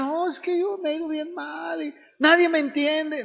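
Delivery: hammed-up self-pity wail; mock whining outburst. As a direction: cry out that nobody understands you. Mocks complainer's outburst.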